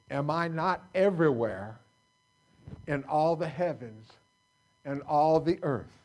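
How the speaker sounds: booming preacher reading scripture; forceful scripture proclamation. Acted. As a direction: proclaim God's question to the room.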